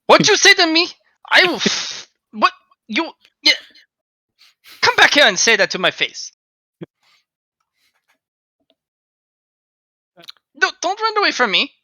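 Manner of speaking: high, loud, outraged shouting; indignant yell. Acted. Former director yelling at Aether as he leaves, outraged shout. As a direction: shout after him, offended.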